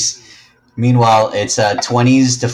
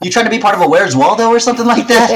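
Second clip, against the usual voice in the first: quick, high-pitched teasing jab; 9 semitones higher, 4 dB louder, faster than usual. Real, not acted. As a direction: rib him playfully about hiding. Teasing friend, fast and high.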